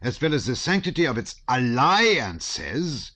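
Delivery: put-on a stout German officer in a thick German accent. A narrator's reading. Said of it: "speaking with a German accent".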